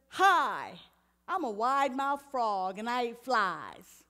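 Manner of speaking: lightly voiced frog, loud and slow; cheery frog. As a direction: announce yourself like a proud little frog. Frog greets the hippo.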